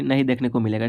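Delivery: ordinary talk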